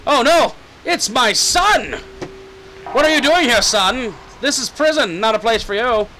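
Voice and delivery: loud, high mock-horrified father; hammed-up shock. Father finding his son in prison, hammed-up shock. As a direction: cry out in exaggerated disbelief.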